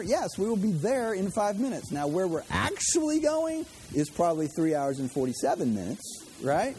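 ordinary delivery

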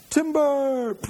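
high call of timber; mock lumberjack shout. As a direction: yell it like a lumberjack. Lumberjack calling out tree fall.

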